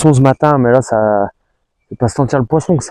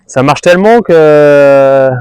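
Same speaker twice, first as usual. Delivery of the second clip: excited; 3 semitones higher, 7 dB louder, much slower than usual. Real, not acted. Fish biting hard, excited.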